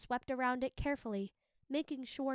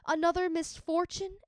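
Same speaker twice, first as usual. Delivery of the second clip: higher, louder dismayed groan; 6 semitones higher, 6 dB louder than usual. A narrator's reading. Epimetheus groaning at yet another misfortune, louder. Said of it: "Epimetheus groaned".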